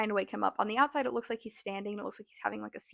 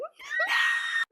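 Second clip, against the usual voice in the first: very high, loud screech; hammed-up high squeal of protest; mock kid screech; 5 dB louder than usual. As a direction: imitate a kid shrieking back. Imitates kids' response to homework.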